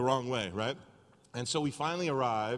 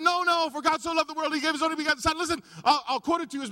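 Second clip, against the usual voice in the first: higher and louder, rushing the verse out; urgent quoting; 14 semitones higher, 6 dB louder, faster than usual. Retold or imitated. Pleading with the driver to put the phone down, louder. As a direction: recite it fast so he drops the phone.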